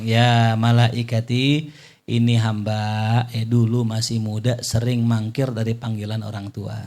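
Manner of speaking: low, very slow grave voice; divine reply. Acted. Allah explaining to the angel, low and slow. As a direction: speak slowly with weight from above.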